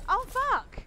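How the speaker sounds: sudden frightened yelp; startled scream. Real, not acted. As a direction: shriek it in real shock. Sudden scare in game.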